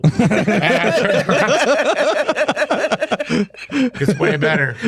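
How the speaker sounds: slow words through laughter; laughing. Real, not acted. Laughing while telling friends he walked off. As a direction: laugh out the line.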